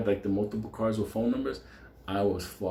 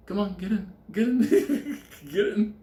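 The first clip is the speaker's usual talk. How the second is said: higher coaxing voice breaking into laughter; coaxing, laughing; 9 semitones higher, slower than usual. Retold or imitated. Benny coaxing the cat into its carrier, laughing. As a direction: laugh while hurrying the cat in.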